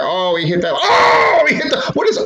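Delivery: mock the commentator's pointless yelling. Mocking commentators who yell every move, shrieking high. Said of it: "Why are you yelling for us".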